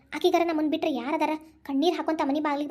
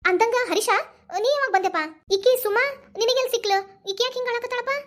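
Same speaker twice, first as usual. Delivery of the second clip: worried surprise; 6 semitones higher than usual. Acted. Mother asks Harish why her daughter is crying, alarmed. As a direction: press him for answers, pitched up with worry.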